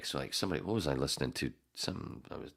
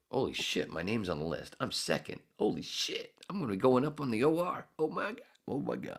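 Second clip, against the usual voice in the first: higher-pitched, mock-amazed inner voice; retold giddy disbelief; 9 semitones higher than usual. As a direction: replay your stunned excitement, pitched up. Retelling seeing his name listed.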